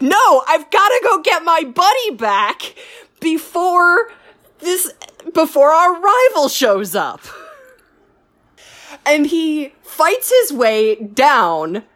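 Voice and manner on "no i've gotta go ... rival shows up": higher, urgent rush to go; urgent resolve. Retold or imitated. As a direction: pitch it up like a man on a mission. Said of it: "so he's like".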